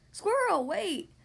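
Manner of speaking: high, loud first word, then falling; calling out. A narrator's reading. Friends calling Squirrel back, calling out. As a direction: call her back with swooping words. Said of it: "her friends called".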